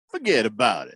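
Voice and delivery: bad New York wiseguy accent; New Yorker accent. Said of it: "you did a New Yorker accent".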